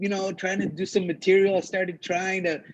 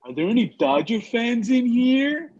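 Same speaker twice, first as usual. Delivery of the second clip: higher, slow call-out to the room; stage host; 5 semitones higher than usual. Retold or imitated. Host asking the audience for Dodger fans, mock announcer call. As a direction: imitate an emcee polling the crowd.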